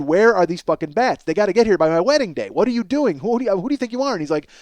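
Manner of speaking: usual speaking voice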